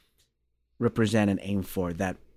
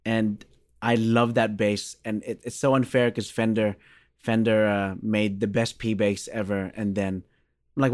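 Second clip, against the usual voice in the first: ordinary talk, nothing marked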